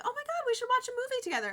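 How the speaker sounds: high, fast eager friend voice; imitating an eager friend; bubbly imitation. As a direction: gush like an excited friend. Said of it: "everybody who has ever been like".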